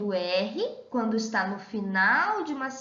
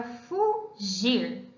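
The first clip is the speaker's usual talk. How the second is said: "fugir" slowly, a clear break between fu and gir; "fugir" drawn out syllable by syllable; split into syllables. Shown as a demonstration. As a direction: say "fugir" in two separate syllables, fu, then gir. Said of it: "Vamos separar essa palavra".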